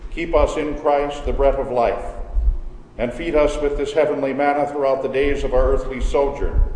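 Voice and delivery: normal talking voice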